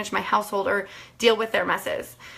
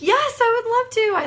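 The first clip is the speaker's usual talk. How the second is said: eager yes; 12 semitones higher than usual. Retold or imitated. Eager reply to friend's invitation.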